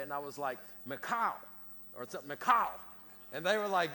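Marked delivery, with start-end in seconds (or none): 0.9-1.3 "McCall" sung out with raised, open last syllable; imitated name pronunciation. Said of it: "the way I heard him say it". 2.4-2.7 "McCall" loud and high, like a bird call; bird-like name pronunciation. Retold or imitated. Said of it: "sounded like a bird".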